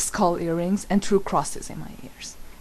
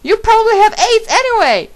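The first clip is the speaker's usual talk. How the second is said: very high, loud spiteful shout; angry yell; 12 dB louder than usual. A narrator's reading. Narrator keeps yelling insults at Draco. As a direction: spit the insult out at full volume.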